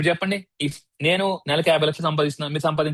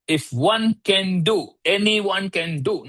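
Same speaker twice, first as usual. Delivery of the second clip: very slow, drawn-out motto; deliberate slow affirmation; 3 semitones higher, much slower than usual. Shown as a demonstration. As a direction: spell it out slowly like a mantra. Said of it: "slow emphatic motto delivery".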